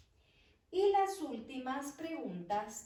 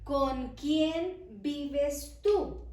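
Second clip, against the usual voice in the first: slow and loud, every word separated; slow emphatic example; 4 semitones higher, 7 dB louder, much slower than usual. Shown as a demonstration. Teacher posing the example question to students, slow and loud. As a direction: present the example sentence firmly. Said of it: "si yo les digo".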